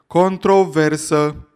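long drawn-out loud slow yell; yelled heckle. Acted. Heckler bellows at the lecturer, slow loud yell. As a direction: bellow the single word slowly from the platform. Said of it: "un glas de pe estradă a urlat".

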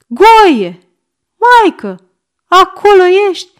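high, loud shouts; urgent calling. A narrator's reading. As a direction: call through a closed door. Calling to boy behind door. (strigăt îngrijorat)

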